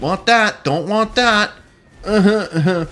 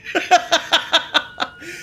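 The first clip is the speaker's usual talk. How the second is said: high, breathless run of chuckles; amused laugh. Real, not acted. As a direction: laugh freely at yourself.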